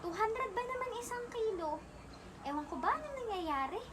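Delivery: high-pitched disbelief at the price; incredulous complaint. Acted. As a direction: question it in exasperated bafflement. Complains about soaring fish price.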